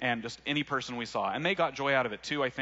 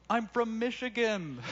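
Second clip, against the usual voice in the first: high, slow put-on voice of a surprised man; stranger's reply voice; 10 semitones higher, slower than usual. Passing man answers the shouting teens in a high slow voice. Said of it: "we heard this voice go".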